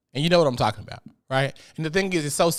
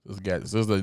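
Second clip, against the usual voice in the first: normal talking voice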